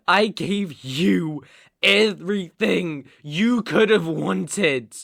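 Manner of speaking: loud, emphatic, slowing words; impassioned reproach. Acted. Man reproaching lover he gave everything, impassioned reproach. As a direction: hit each word loudly.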